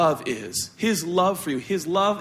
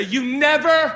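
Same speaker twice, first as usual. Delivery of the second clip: mock accusation; 6 semitones higher, 4 dB louder, slower than usual. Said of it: "you can't say".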